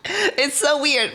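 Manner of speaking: drawn out through a laugh; slow amused laugh-talk. Real, not acted. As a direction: say it while cracking up. Laughing at own voice.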